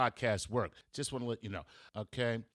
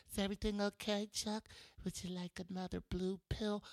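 higher, softer, sweetly condescending nurse-doctor voice; patronizing doctor; 8 semitones higher, 7 dB quieter than usual. Retold or imitated. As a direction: coo at the patient like a mental ward doctor. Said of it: "the doctors come by and they check on me".

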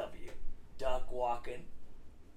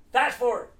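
high, loud call; loud announcement; 10 semitones higher, 12 dB louder than usual. Real, not acted. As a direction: announce it loudly. Skipping ahead.

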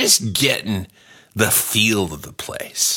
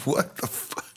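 shocked whisper; 5 semitones higher, 9 dB quieter than usual. Acted.

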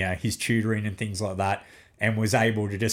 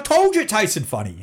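son's told-you-so; 10 semitones higher, 4 dB louder than usual. Retold or imitated. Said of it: "And he's like".

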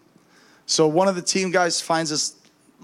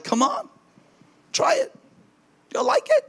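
coaxing. Retold or imitated. Coaxing the devil worshipper.

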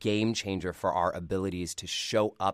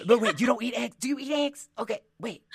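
much higher, puzzled questioning; mock confused relative; 4 dB louder than usual. Relative puzzling over her diet, high and bewildered. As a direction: fret over the eggs.

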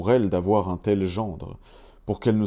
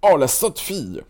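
high, loud dwarf casting a curse; dwarf cursing the girl, high and loud; 7 semitones higher, 4 dB louder than usual. A narrator's reading. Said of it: "dit un troisième".

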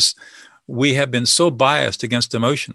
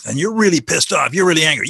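louder and higher, matching their heat; forceful mirroring; 5 semitones higher, 4 dB louder than usual. Acted. Listener mirroring an angry speaker's emotion, loud and intense. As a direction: throw the anger back at them.

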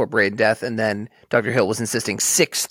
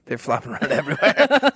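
laughing; 11 semitones higher than usual. Real, not acted. Repeating the joke, cracking up.

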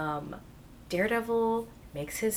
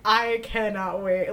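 ordinary delivery

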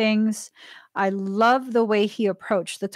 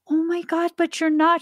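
higher-pitched retold disbelief; dismayed protest; 6 semitones higher, faster than usual. Retelling her reply to her friend, dismayed protest. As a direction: protest in a raised, held pitch.

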